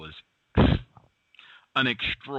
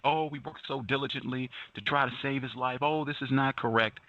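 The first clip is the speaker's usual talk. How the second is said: higher mock-earnest lament; 8 semitones higher than usual. Host mimicking activists claiming credit, higher mock-earnest whine. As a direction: parody their hollow distress. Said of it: "they are the spotlight".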